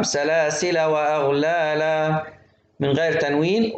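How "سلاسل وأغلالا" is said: slow chant, سلاسل ending short "la"; wasl, open lam. Shown as a demonstration. Teacher reciting the joined form, short fatha on سلاسل. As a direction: link سلاسل onward without tanween. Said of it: "تقرأ وصلًا بفتح اللام".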